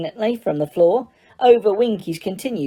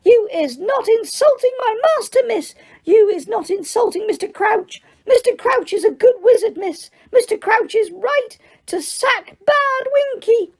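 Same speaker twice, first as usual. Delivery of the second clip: screeching elf. Acted. Winky defends her master to Hermione, screeching. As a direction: screech it in outrage, covering her ears. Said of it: "and screeched".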